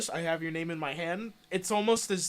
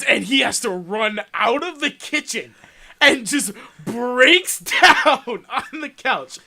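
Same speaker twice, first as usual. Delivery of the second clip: ordinary delivery, nothing marked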